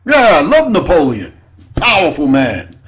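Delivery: ordinary talk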